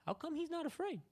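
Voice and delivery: squeaky, incredulous question; high-pitched bafflement. Retold or imitated. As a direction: pitch it right up in mock disbelief. Amazed at Jesus' calm, acted.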